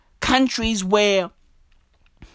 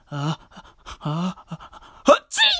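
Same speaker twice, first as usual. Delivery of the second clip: imitated sneeze; 4 semitones lower, 4 dB quieter than usual. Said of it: "Some lady fucking sneezed".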